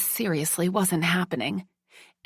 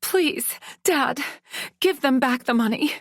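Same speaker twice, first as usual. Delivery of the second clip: begging; 8 semitones higher than usual. Acted. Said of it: "I begged".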